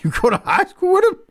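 ribbing Harper again over a forgotten name, in squeaky mock disbelief; mock incredulous. Teasing a buddy again, high and incredulous. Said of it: "callback teasing Harper".

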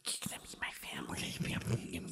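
slow, creepy whispered threat. Retold or imitated. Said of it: "the creepy Agnes voice was just like".

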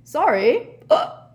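loud, high, then a hiccup. A narrator's reading. Old professor apologises for singing, with a hiccup. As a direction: break off on a sudden hiccup. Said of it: "said Slughorn with a hiccup".

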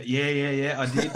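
imitating a mate's fib through laughter; laughing fib. Teammate mimicking Bud's lying answer, laughing. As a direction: laugh out a cheeky yes while lying. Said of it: "And you'd say".

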